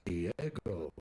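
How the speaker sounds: dropped low and rolled, exotic name; wife savoring the dog's name, low and sultry; Diego said deep. Retold or imitated. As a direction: say Diego like a suave lover's name. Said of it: "She goes".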